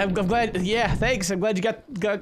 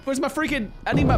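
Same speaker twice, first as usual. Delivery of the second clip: ordinary delivery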